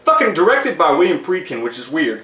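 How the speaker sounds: ordinary talk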